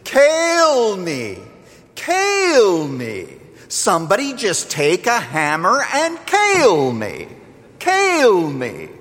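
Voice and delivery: high, drawn-out Appalachian wail; hammed-up wail of a woman in labour, falling on each plea; imitated crying out. Young Appalachian woman in labour begging, imitated crying out. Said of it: "she cried out continuously".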